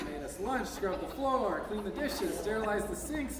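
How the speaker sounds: ordinary talk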